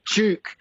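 "Juke" said with a j sound; Duke with J. Shown as a demonstration. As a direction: merge d and y into "Juke". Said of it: "pronunciation there with a J".

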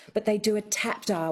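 normal talking voice

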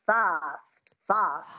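fast with a long open 'ah' vowel; fast said 'fahst', transatlantic. Shown as a demonstration. Coach corrects learner, fast with an 'ah'. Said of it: "just make it fast".

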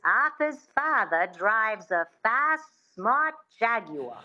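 slow transatlantic accent with a witchy twang. Shown as a demonstration. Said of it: "it's sort of that sound".

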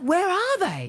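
squeaky high voice, imitating her; mock high-pitched voice of the woman asking. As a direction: put on a shrill-toned quote of her asking. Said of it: "And she's like".

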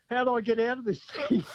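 mock panicked wife. Caller retells his wife's reaction to the ride, mock panicked. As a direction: imitate a panicking wife, half in fun. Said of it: "my wife was panicking".

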